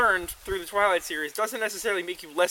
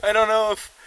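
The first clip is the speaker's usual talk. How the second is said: laughing; 4 semitones higher, 6 dB louder than usual. Real, not acted. Laughing mid-sentence.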